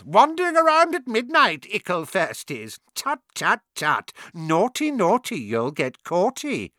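high, loud teasing cackle voice; mischievous poltergeist. A narrator's reading. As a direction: taunt them like a gleeful imp.

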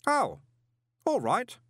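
high, innocent acceptance; agreeable boy. A narrator's reading. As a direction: accept it lightly in a boy's voice.

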